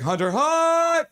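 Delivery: high, stretched-out cheer; hype cheer. Acted. Streamer voicing a viewer's cheer, hype cheer. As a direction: launch up high and hold the last word. Acting out a hype chat message.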